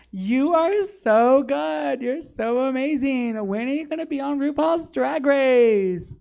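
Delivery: high, loud, drawn-out gushing fan impression. Fan raving to a drag queen after the show, mock gushing. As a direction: gush like an overexcited fan, mocking and high. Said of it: "when they go like".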